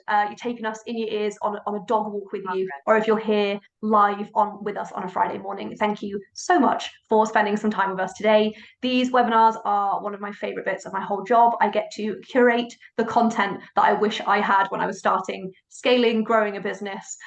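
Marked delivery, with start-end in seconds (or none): none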